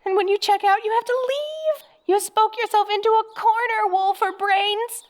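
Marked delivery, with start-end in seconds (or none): none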